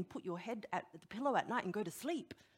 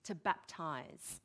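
slow emphasis; 3 semitones lower, much slower than usual. Real, not acted. Preacher slows on key word.

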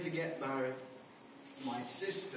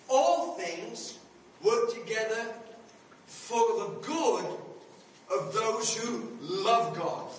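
louder and slow, leaning on each phrase; emphatic scripture repeat; 3 semitones higher, 5 dB louder, slower than usual. Acted. Preacher repeats verse to congregation, louder, slow and emphatic. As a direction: press the verse home to the congregation.

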